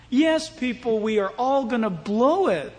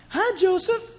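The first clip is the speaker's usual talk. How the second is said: high-pitched coquettish greeting; flirty Potiphar's wife; 8 semitones higher than usual. Acted. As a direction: lift it into a flirty woman's voice. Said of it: "she's casting long eyes at him".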